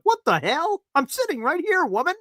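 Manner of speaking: high put-on squeal of jealous outrage; mock outrage. Host to his fiance, mock jealous outrage in a high voice.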